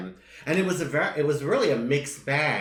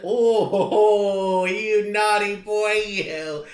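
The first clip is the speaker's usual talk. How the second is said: flirty; 5 semitones higher, 6 dB louder, much slower than usual. Pretend. Flirty teasing of a co-host.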